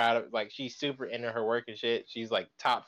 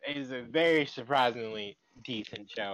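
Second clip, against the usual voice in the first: usual speaking voice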